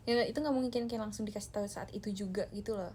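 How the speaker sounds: ordinary talk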